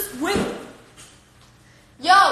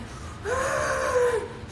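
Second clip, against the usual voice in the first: mock gasp; 9 semitones higher than usual. Boyfriend's reaction to the Starbucks cup, high shocked gasp. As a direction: imitate his startled gasp. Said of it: "this fucking man right here was like".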